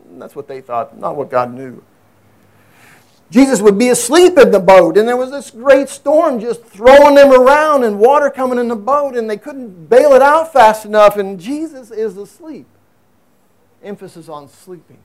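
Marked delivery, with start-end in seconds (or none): 3.3-12.7 higher, louder storytelling sermon; dramatic preaching. Acted. Pastor telling the congregation about the storm, in rousing preaching. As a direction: paint the storm with preacher's fire.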